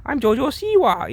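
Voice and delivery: very high, whiny mock baby talk; squeaky put-on baby voice. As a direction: talk like a little kid to mock. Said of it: "the baby talk".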